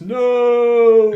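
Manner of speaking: far-off shout; distant shout. Acted. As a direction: call it from a distance. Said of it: "a disembodied voice shouts from the distance".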